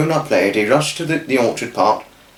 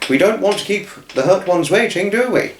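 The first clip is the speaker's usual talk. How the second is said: cheery father; 7 semitones higher than usual. Acted.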